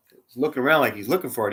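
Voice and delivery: ordinary delivery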